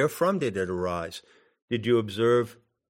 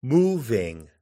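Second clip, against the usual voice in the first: "moving" stressed on its first syllable, then falling; stress on first unit. Shown as a demonstration. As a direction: say "moving" as a trochee, MOV-ing. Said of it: "on the first unit".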